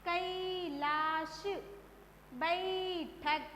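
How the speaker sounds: कैलाश, बैठक very slow, first syllable stretched; बैठक with a long, weighted bai; stressed long ai. Shown as a demonstration. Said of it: "बा को हमने जोर देकर बोला".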